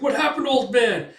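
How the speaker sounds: high, loud worried call; alarmed question. Retold or imitated. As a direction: call out urgently to someone hurt. Startled question after a scream.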